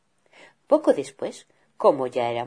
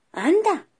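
mild surprise; 5 dB louder than usual. Acted. Mother bear surprised.

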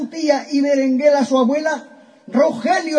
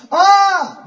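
high, louder gleeful ah; 9 semitones higher, 5 dB louder than usual. A narrator's reading. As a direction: burst out with joy. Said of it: "vuelve a decir gozoso". (¡Ah! gozoso, agudo y fuerte)

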